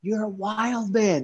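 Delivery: high, slow, adoring exclamation on wild; impressed wife. Retold or imitated. Wife praising husband home from the show, high and slow adoring gush. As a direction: gush at him, stretching wild man. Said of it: "And she's like".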